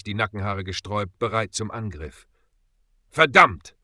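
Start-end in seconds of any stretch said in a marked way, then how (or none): 3.1-3.8 high, loud trembling curse; shaky curse. A narrator's reading. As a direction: curse with a shaking voice. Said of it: "fluchte Bob mit zitternder Stimme".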